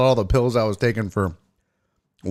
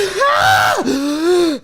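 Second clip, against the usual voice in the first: loud, high, frantic exhale; panicked shouted sigh; 4 dB louder than usual. Retold or imitated. Patient mocking her own frantic breathing for the doctor.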